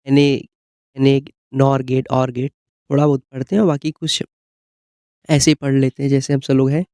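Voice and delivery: usual speaking voice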